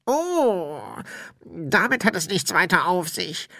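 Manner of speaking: high-pitched put-on spirit voice; explaining ghost.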